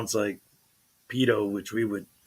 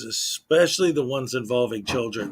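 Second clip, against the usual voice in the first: normal talking voice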